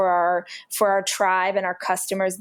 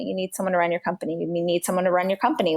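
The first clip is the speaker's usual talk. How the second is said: usual speaking voice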